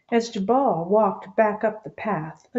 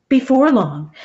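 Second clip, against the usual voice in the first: ordinary delivery, nothing marked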